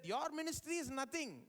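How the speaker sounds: imitated bragging; mock boastful. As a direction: play the scornful rival preacher. Acting out boastful critics of his church.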